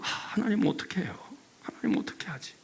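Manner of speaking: soft worried whisper; whispered prayer. Retold or imitated. A believer praying for someone, whispered. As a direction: breathe the worry to God.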